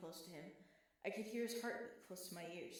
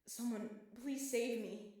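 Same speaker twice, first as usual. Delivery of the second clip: desperate plea; 4 semitones higher, 7 dB louder, slower than usual. Acted. Desperate plea, can't breathe.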